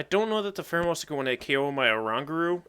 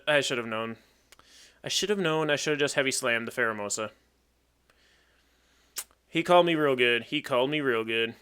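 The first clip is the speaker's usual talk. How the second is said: usual speaking voice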